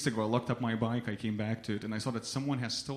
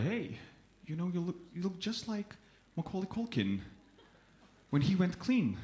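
lightly voiced girl, higher and softer; imitated girl; 6 semitones higher, 4 dB quieter than usual. Girl accosting a stranger in a grocery store, imitated. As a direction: put on a light, higher girl's voice.

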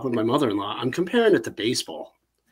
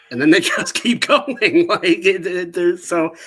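amused, higher and louder through laughter; laughing; 5 semitones higher, 5 dB louder than usual. Real, not acted. Host mocking how the films just keep going, laughing. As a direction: let laughs break into the words.

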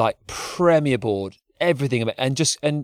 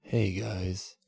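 low, slow laid-back mock surfer voice; lazy chill-out drawl; lightly voiced mellow dude; mellow Jerry; 6 semitones lower, 9 dB quieter, slower than usual. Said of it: "Jerry gets in the mix and starts going".

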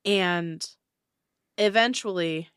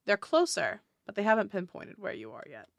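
usual speaking voice

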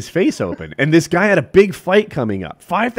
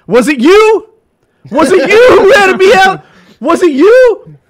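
high, loud enraged yelling; retelling a fighter's rage as he confronts the gym; furious accusation; 14 semitones higher, 10 dB louder than usual. Retold or imitated. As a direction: scream the accusation at each person, much higher and louder. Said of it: "He goes all red faced".

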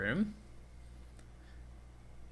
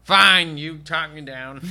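hammed-up surrender; 3 semitones higher, 6 dB louder, slower than usual. Spoof dealer giving in.

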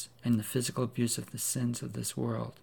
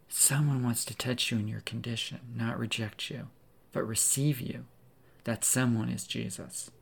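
normal talking voice

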